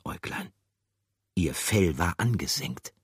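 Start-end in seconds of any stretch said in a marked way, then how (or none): none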